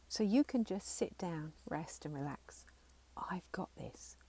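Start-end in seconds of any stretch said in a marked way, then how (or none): 3.2-4.2 whisper. Shown as a demonstration. Soothing inner child.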